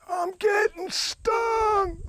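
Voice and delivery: very high, drawn-out comic wail; owl guy's mock scream. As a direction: imitate a bandmate wailing mid-song, stretched and high. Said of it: "he leans over and he goes".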